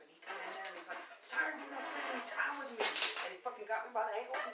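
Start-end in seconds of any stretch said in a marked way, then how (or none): 1.3-2.8 a hint of a whiny complaining husband. Wife mimicking husband's complaint, a touch whiny. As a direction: lightly mimic his needy grumble.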